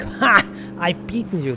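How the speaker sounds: mock gloating, a high jeering "Ha!"; gloating taunt. Others taunting streamer with a mock gloat. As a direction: crow over the overtaken player. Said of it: "then they go like".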